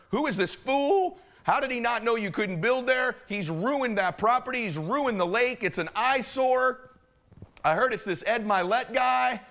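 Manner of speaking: high, drawn-out scandalized onlookers; mock outrage. Boaters gossiping about the ruined lake, high mock outrage. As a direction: imitate gossips aghast, stretching the key words.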